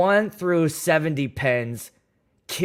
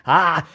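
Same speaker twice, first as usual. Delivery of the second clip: mock startled yelp; 7 dB louder than usual. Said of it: "I'm like".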